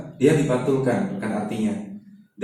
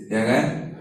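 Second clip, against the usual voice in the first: ordinary talk, nothing marked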